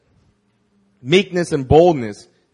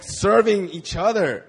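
ordinary delivery

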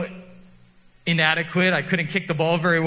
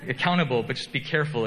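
ordinary talk

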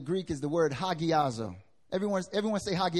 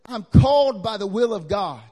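loud, ringing declaration; Paul's claim thundered out; booming proclamation; 2 semitones higher, 11 dB louder than usual. Acted. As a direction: proclaim it to the whole room. Preacher voicing Paul's claim.

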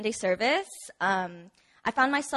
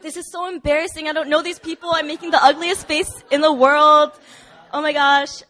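louder and higher, flustered and mortified; embarrassed outburst; 5 semitones higher, 7 dB louder than usual. Retold or imitated. Hugged by strangers while bawling, an embarrassed loud outburst. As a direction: blurt it out, half laughing at herself.

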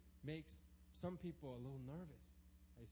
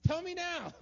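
loud, high mock-frantic demand; demanding an answer; 14 semitones higher, 11 dB louder than usual. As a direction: insist like an anxious controlling person. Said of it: "they have to have an answer".

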